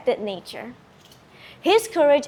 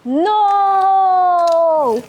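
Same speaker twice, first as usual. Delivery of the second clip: long, much louder, higher scream; serpent's scream; 7 semitones higher, 7 dB louder than usual. Acted. Defeated serpent screams as it sinks, long wail. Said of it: "the serpent screamed".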